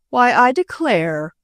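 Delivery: slow, surprised and delighted; surprised exclamation. Acted. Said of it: "he exclaimed in real surprise".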